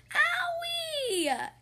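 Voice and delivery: a high, sudden little yelp of hurt; pained yelp. Acted. Amelia yelps when Skip's elbow bumps her mouth, sharp high cry. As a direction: yelp like a bumped child. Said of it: "yelled Amelia Bedelia".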